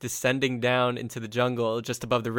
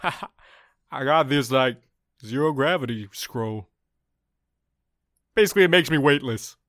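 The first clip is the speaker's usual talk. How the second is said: lightly voiced dragon, slightly raised; a hint of a big talking dragon; dragon character explaining; 3 semitones higher, 6 dB louder than usual.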